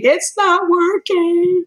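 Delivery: high, slow, tearful wail; mock crying, high and slow. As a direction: break down saying it. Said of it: "I say I cried".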